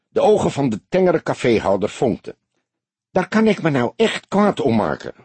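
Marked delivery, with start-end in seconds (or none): 3.1-5.1 indignant. A narrator's reading. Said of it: "riep hij verontwaardigd".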